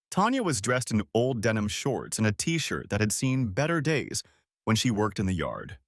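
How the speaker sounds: plain reading voice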